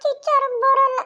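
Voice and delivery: put-on high, childish whine; whiny little boy. Little boy whining to grandma about school, high childish voice. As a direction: play a small boy refusing to go.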